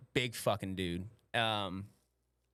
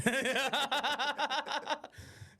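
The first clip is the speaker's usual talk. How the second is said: high, drawn-out laughing fit; big laugh; 14 semitones higher than usual. Real, not acted. Cracking up at the buddy's excuse, high laughter. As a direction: crack up at your own bit. Laughing at own mock quote.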